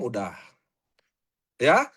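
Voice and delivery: ordinary delivery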